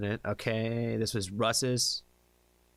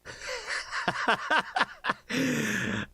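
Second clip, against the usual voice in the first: laughing; 14 semitones higher than usual. Real, not acted. Reacting to a funny request.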